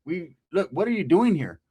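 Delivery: ordinary delivery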